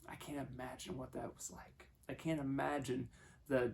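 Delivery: usual speaking voice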